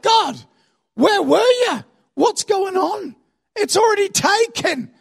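high, drawn-out indignant cry; hammed-up outraged wail to heaven; yelling at God. Israelites finding the good stuff gone, yelling at God. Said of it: "then they yell at God".

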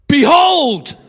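"Behold" rung out high and loud, like a herald; "Behold" as a loud, high proclamation; proclaimed Behold. Shown as a demonstration. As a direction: announce "Behold" with full force. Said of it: "Because you can't say".

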